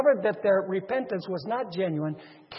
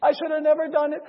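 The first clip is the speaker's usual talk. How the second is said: acted wailing apology; mock crying; 5 semitones higher, 7 dB louder than usual. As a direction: plead like a debtor caught out, hammed-up. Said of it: "He starts crying out".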